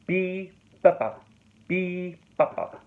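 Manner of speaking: "Be" stressed high, "papa" light and quick; rhythmic sounding-out of the dactyl; dactyl rhythm syllables. Shown as a demonstration. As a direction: beat out "Be, papa" as long-short-short. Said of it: "That's a dactyl".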